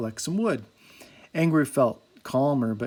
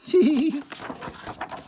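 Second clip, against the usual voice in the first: high, far-off merry chuckle; Jolly laughing about Angry, merry chuckle; 12 semitones higher than usual. A narrator's reading. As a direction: chuckle like a cheerful dwarf. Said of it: "chuckled Jolly".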